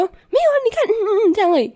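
very high exaggerated mimicry of her; mocking mom. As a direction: mom mimicking a tiny cough. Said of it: "她说".

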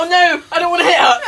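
loud, high, laughing mock panic. Friend voicing Lando mid-crash, laughing and shouting. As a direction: cry out in pretend alarm while cracking up.

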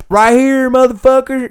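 high-pitched retold comeback; mock defiant holler. Storyteller answering the homeless man's dare, high mock holler. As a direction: fire it back as a hammed-up challenge.

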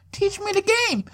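high, eager quoted line; a hint of an eager student; Power quote. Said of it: "I feel like fucking Ghost from Power".

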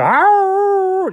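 high put-on "arr"; mock hound howl. A high hound howl imitated for the dog.

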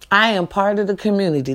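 ordinary talk

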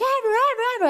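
high, thrilled repeat of the name; excited squeal. Retold or imitated. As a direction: gasp it out like a starstruck fan. Excited recognition, retold.

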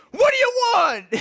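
very high, loud mock-furious yell; angry demand. Homeowner to intruders after saying hi, hammed-up angry shout. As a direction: screech the demand in hammed-up outrage.

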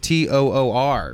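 usual speaking voice

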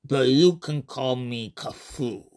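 slow, calm otherworldly alien voice; alien being. Acted. Alien Kafu introducing itself to the human, slow and calm. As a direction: speak as a patient visitor from another dimension.

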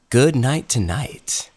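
slow, drawn-out title in a nighttime voice; late-night show voice. Real, not acted. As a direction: say it like it's nighttime. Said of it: "I have to say it like that".

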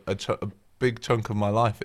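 normal talking voice

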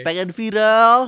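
slow drawn-out tagline call; announcer tagline. Shown as a demonstration. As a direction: announce it slowly like a jingle. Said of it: "Aku yang bilang ya".

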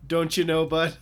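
God as a Wisconsin local, hammed up; Wisconsin accent. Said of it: "God with a Wisconsin accent".

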